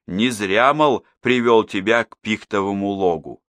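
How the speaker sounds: usual speaking voice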